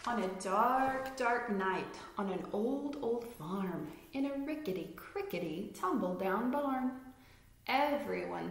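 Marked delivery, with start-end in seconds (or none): none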